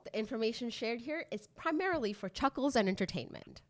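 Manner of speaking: upbeat scripted intro read; podcast intro read. Acted. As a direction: read it like a show opener.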